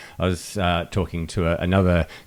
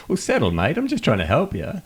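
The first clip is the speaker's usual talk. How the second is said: mock-defensive, higher and clipped back; defensive retort; 9 semitones higher than usual. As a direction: brush off the caller's outrage. Help desk guy retorts.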